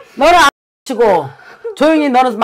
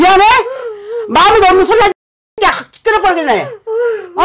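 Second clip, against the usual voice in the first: "마음에도 없는 소리 하지" spat out higher with a sob in it; angry scolding; 9 semitones higher, faster than usual. Acted. Shaman rebuking the husband for his fake apology, angry. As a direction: call the apology fake, half crying.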